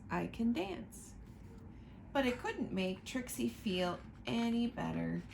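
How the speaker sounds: ordinary delivery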